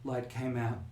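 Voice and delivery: soft, halting murmur; quietly dazed, understated delivery; faint muttering. Acted. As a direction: let the words out in fragments, long pauses between. GM voices the muttering Amelia.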